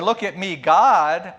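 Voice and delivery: ordinary delivery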